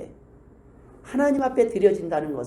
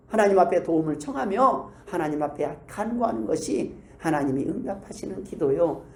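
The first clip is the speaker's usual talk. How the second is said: usual speaking voice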